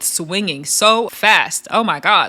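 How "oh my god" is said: ordinary delivery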